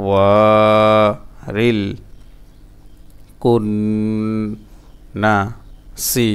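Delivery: বিল to সি chanted piece by piece with long gaps; broken-up syllables. Shown as a demonstration. Teacher drilling learners, slow syllable-by-syllable chant. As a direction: stretch খুন, ওয়া and কুন, pausing after every syllable. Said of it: "ভাঙা ভাঙা উচ্চারণ".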